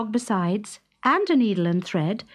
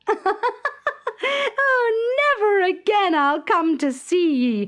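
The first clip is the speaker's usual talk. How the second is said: high, laughing mockery; laughing taunt; 9 semitones higher, slower than usual. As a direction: tease him as she runs off. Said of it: "flung back her head".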